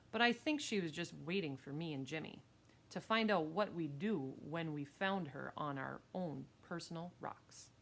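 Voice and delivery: ordinary delivery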